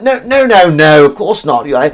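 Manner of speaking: high, loud posh English voice; exaggerated posh gushing; played-up posh. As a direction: lay the posh accent on thick. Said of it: "I played up a bit".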